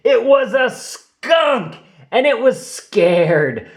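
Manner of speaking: high, slow, laughing reveal; amused surprise. A narrator's reading. Narrator revealing the skunk, amused and surprised. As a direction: let the twist land with a giggle.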